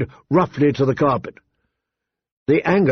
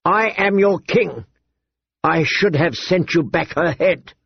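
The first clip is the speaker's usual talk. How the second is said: growled warning; 5 semitones higher than usual. A narrator's reading. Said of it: "Viserys snarled".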